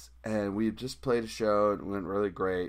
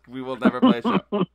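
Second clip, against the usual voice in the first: higher, louder through laughter; laughing; 10 semitones higher, 9 dB louder than usual. Real, not acted. Laughing while retelling.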